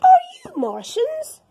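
high, loud calling question. Acted. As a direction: George calls across to the aliens. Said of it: "called George".

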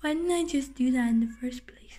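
quick voiceless whisper. Acted. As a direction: whisper it to yourself. Sneaky aside whisper.

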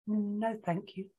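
small, soft, reluctant refusal; quiet, understated sniffy child. A narrator's reading. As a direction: turn it down quietly, a little sulky. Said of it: "sniffed Tisha".

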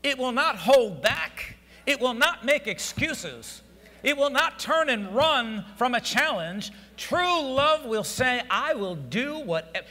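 loud, ringing pulpit build; preaching at full force. Acted. Preacher exhorting the congregation, loud and rising. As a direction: lift the church with it, full voice.

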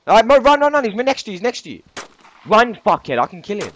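high, loud urgent shouting. Real, not acted. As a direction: yell at him to run. Urgent order to teammate.

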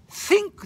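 "Think" said as "sink", with an s; Japanese-accented English. Shown as a demonstration. Showing how Japanese people may say "Think", as "sink". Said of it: "日本人は「I think」って言えばいいんですよ".

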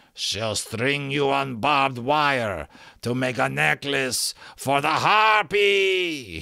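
slow put-on villain voice building to a high, loud shout; threatening Rook. A narrator's reading. Rook threatening the magician with barbed wire, slow threat rising to a shout. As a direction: draw the threat out slowly, end shouting.

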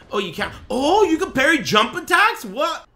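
high, loud, rising disbelief; astonished shouting. Real, not acted. Streamer discovering a parry in game, astonished shout.

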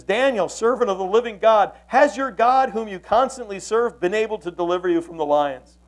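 acted king's troubled cry, easing at the end. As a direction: call out to someone who may be dead. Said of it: "He cries out with a troubled voice".

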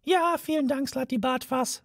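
high, curt mouse voice; a tiny mouse cutting him off sharply; high and curt, a mouse dismissing someone. A narrator's reading. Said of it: "sagte Benji Maus scharf".

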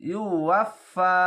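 ইউ ওয়াফা with ফা held for one alif length; drawn out one alif. Shown as a demonstration. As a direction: stretch the ফা in ওয়াফা slowly. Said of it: "এক আলিফ টেনে পড়বেন".

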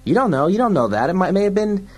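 high, insistent babble; agitated sidekick. Acted. Fergie haggles over the seconds, high and frantic. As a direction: keep arguing in a high voice. Fergie figure keeps arguing.

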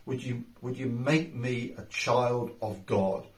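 low, soft, slow prayer voice; leading a prayer. Real, not acted. Pastor leading listeners in a sinner's prayer, hushed and slow. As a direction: pray it quietly and humbly, line by line. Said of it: "I would ask you to pray this prayer".

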